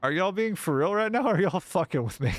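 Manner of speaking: high, loud disbelief; can't believe they're joking; loud outraged disbelief; incredulous. Real, not acted. Disbelief at chat's prank.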